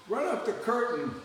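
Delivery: loud, high call to the wings; old comic's loud, high-pitched stage call. Retold or imitated. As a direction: shout a stage order offstage.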